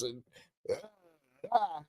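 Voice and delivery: usual speaking voice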